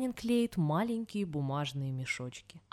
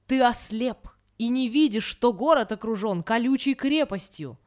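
raised, louder angry general; shouting; 4 semitones higher, 6 dB louder than usual. A narrator's reading. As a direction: cry out at him in exasperation. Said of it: "Тогда генерал закричал". (сердитый выкрик)

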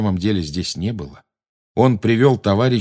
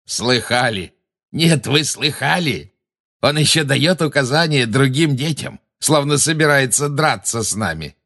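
mocking cackle; 6 semitones higher than usual. A narrator's reading. Bellatrix mocks Harry.

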